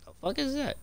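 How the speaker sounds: high nervous question; squeaky startle. Real, not acted. Startled by something in game.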